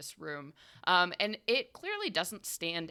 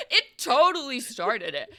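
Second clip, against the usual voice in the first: loud, high burst through laughter; laughing agreement; 10 semitones higher, 19 dB louder than usual. Real, not acted. Game master backs player's joke, laughing agreement. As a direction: crack up while agreeing.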